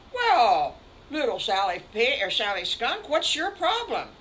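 big, loud, hearty voice that climbs high at the end; booming farmer. Acted. Farmer Brown asks Sally Skunk her problem, loud and hearty. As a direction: call out like a jolly farmer.

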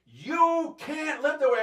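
high, loud imitation of a scolding mother; arguing mother. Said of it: "we'd argue. She'd go".

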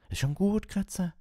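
put-on high boy's voice calming a pet; soothing boy. A narrator's reading. Ron calms his squirming rat, soothing. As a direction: gently hush a frightened rat.

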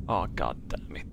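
ordinary talk, nothing marked

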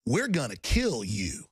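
slow, drawn-out mock threat; deliberate, lightly menacing. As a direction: imitate a movie gangster's slow threat. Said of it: "Did this thing with his teeth".

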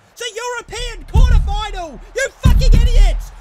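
real full-volume rage at the players; high, very loud yelling; furious shout. Real, not acted. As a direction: yell it at the team, livid. Raging at players.

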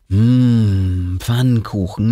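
a relishing hum, then the word Pfannkuchen drawn out lovingly; savoring pancakes. A narrator's reading. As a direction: taste the word Pfannkuchen like a treat. Savoring favourite food.

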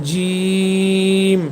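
জিম with a very long "ee" closing on m; mid-tongue to palate, sustained জিম; full letter sound. Shown as a demonstration. Teacher demonstrating the letter jim, long held জিম. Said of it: "এই জিম হরফটি উচ্চারণ করবেন".

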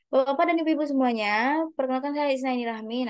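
rushed, fast introduction; too fast. Shown as a demonstration. Teacher showing a rushed self-introduction. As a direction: gabble the name out too quickly. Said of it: "Nah, itu kan cepat banget".

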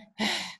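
short voiceless puff of h; breathed h sound. Shown as a demonstration. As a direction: demonstrate a final h as a puff of breath. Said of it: "at the end".

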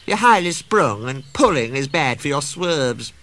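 high-pitched, fussy railcar voice; self-important Daisy, precious. A narrator's reading. Daisy quotes her fitter to the staff, self-important. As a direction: lay down the law with the fitter's authority.

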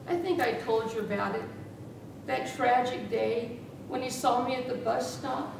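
ordinary delivery

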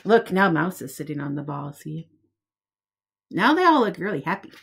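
lively picture-book read-aloud; reading to children. Acted. Teacher reading a picture book to preschoolers, lively storytime voice. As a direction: read it out like storytime for little ones.

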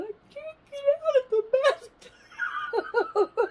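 high, loud crying wail; sobbing. Retold or imitated. Retelling the bathtub panic to viewers, high crying wail. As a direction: sob it out in panic.

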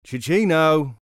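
loud, high searching call. A narrator's reading. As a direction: call the boy's name to find him. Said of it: "they called".

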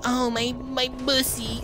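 high, slow, breathy-voiced Cortana; hammed-up high AI lady voice, laughing; Cortana impression. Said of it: "Cortana's gonna be like".

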